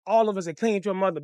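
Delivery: ordinary delivery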